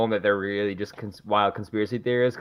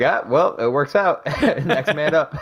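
mock shrug; 6 semitones higher, 4 dB louder than usual. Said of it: "Just like".

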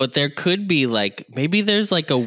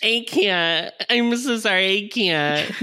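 high mock sobbing whine; mock crying; 9 semitones higher, slower than usual. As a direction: whine it like a pretend breakdown. Mimics her distress, high.

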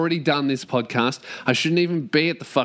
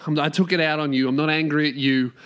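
usual speaking voice

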